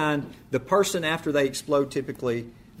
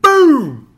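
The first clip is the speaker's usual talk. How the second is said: shouted boom, much higher and louder; sudden loud explosive boom; explosion boom; 13 semitones higher, 15 dB louder than usual. Retold or imitated. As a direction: burst out like a bomb going off. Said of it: "it's just like".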